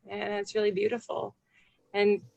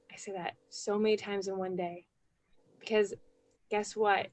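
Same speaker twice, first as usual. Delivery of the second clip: normal talking voice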